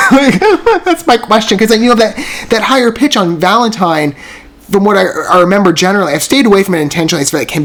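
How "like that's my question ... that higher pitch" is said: laughing. Real, not acted. Laughing at her own reaction.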